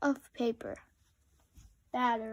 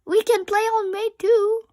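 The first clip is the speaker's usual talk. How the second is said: shouting; 9 semitones higher, 8 dB louder, faster than usual. A narrator's reading. Rabbit still shouting.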